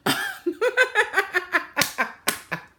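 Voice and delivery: long, high, loud laugh; high-pitched run of laughter. Real, not acted. Laughing at herself mid-story.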